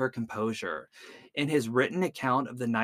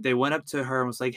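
ordinary delivery, nothing marked

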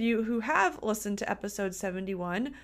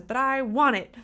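mild mock tantrum, louder; child's tantrum; 3 semitones higher, 6 dB louder than usual. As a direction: push it out like an insisting kid. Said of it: "she throws tantrums and says".

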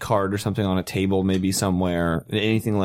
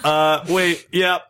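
ordinary talk, nothing marked

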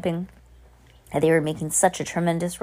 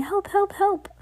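high-pitched mock cry of alarm; mock yell for help. A narrator's reading. Witch imitating teachers up on their desks, high mock yell for help. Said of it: "and yelling".

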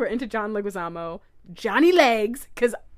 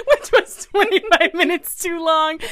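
higher, louder, slower with laughter; laughing echo; 8 semitones higher, 9 dB louder, slower than usual. Real, not acted. Echoing her friend's joke about the date, laughing louder and higher. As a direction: repeat the joke while laughing.

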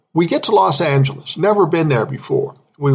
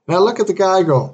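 usual speaking voice

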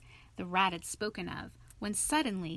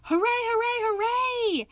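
very high, loud cheering; loud, shrieking hoorays on a high note; jubilant shout; 7 dB louder than usual. A narrator's reading. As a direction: shout in triumph, held high. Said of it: "the rat cried".

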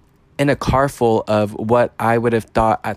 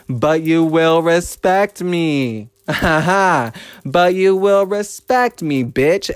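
higher, slow, stretched, laughing threat; laughing warning; 6 semitones higher, much slower than usual. Real, not acted. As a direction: lay down the law with a cackle.

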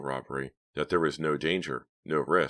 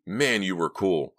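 slow, louder praise, pitch lifted; admiring; 4 semitones higher, 5 dB louder, slower than usual. A narrator's reading. Back-seat partner praising the driver, admiring. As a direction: stretch out the congratulation. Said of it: "Mickey congratulated her".

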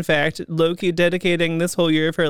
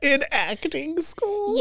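slow, higher, teasing "In acting school?"; mock disbelief; 10 semitones higher, 4 dB quieter, slower than usual. Real, not acted. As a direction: tease her with exaggerated surprise. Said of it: "actors go to acting school".

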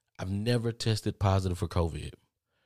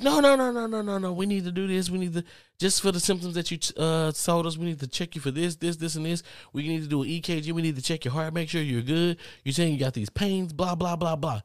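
much higher, fussy nurse voice; higher, lightly mocking medical staff rattling off orders; insistent nurse; 8 semitones higher than usual. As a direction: play the nurse listing tests, pitched way up.

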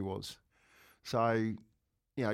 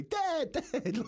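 put-on high, urgent child calling Dad; daughter screaming; 14 semitones higher than usual. Daughter calling her dad from across the calf day, high frantic mock yell. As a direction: cry out for Dad like a frantic little girl, much higher. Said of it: "She's screaming at me".

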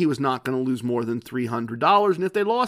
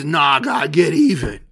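louder, mock drunken protest; drunk husband; 5 dB louder than usual. Drunk husband refusing his wife's advice, loud mock slur. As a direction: imitate a drunk brushing off his wife. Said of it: "he was drunk".

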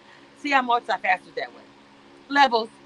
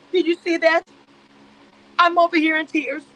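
mock tearful; 7 semitones higher, 5 dB louder than usual. Mock weeping over Amira.

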